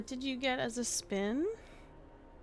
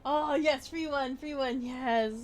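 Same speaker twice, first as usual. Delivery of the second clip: excited cheer; 3 semitones higher, 7 dB louder than usual. Real, not acted. Streamer celebrating a free one on the wheel, excited cheering. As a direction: cheer the lucky result out loud.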